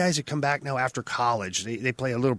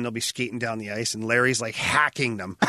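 ordinary talk, nothing marked

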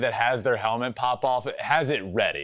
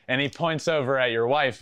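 ordinary talk

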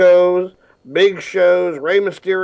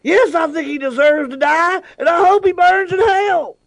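Samuel L. Jackson voice; 14 semitones higher than usual. Pretend. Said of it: "Samuel, what do you think".

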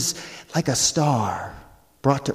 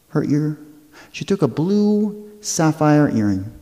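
plain reading voice

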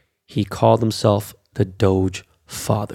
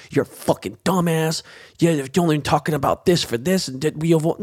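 higher-pitched mock hater rant; 5 semitones higher than usual. Crypto host mimicking hateful critics of Dogecoin, mocking. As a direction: imitate haters spitting insults, voice pitched up. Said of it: "I see people truly hate".

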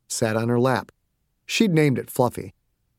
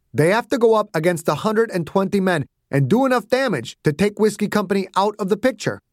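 lightly voiced, higher-pitched officer; general arguing his case; 6 semitones higher than usual. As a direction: reason firmly with a young commander.

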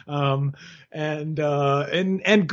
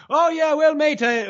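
put-on Irish Australian accent; 9 semitones higher than usual. Host mimics Brook's promoter in an Irish Australian accent. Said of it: "I turned into an Irish Australian there".

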